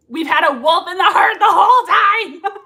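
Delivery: high, loud exclaim; excited shout. Real, not acted. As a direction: shout the reveal, thrilled. Quoting the reveal line.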